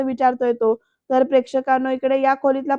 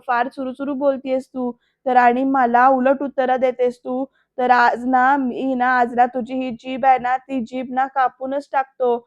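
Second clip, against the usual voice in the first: usual speaking voice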